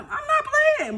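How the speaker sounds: put-on a child swearing she's telling the truth; imitating her daughter. Daughter telling Mom she isn't playing, in a high child voice.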